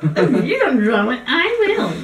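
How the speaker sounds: high, loud parroting with a laugh; mocking mimicry. Contestant repeats the host's threat back, mocking. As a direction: throw the words back in a silly high voice.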